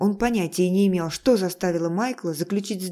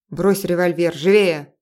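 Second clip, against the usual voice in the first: louder barked command; harsh order; 7 dB louder than usual. Acted. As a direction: force him to drop it. Lir orders with knife.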